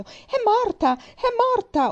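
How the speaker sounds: high, loud cry of horror; horrified scream. A narrator's reading. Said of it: "urlò Mary".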